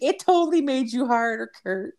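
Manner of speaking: put-on high-pitched teasing girlfriend voice; imitated girlfriend. Girlfriend teasing Kurt in front of friend, high imitated voice. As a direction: mimic her in a high, put-on voice.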